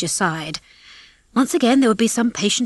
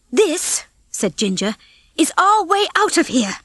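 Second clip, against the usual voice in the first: Ginger's voice; 9 semitones higher than usual. Acted. Ginger announcing escape plan.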